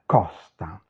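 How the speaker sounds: costa with an open o. Shown as a demonstration. Teacher showing the Italian vowel, open o in costa. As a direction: say costa low, with the o opened wide. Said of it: "En italien, c'est ouvert".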